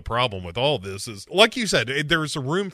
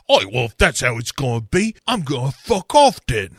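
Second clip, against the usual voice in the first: quick, louder mock cockney; cockney quitting; 4 dB louder than usual. A Spice Girl to Clifford, mock cockney walk-out. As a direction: storm off in put-on cockney.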